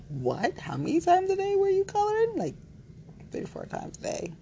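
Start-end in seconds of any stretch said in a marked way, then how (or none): 0.1-2.3 high, quick incredulous quote; shocked mock question. Someone asking how often we colored, incredulous. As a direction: imitate someone taken aback, pitch shooting up. Imagined listener reacting in shock.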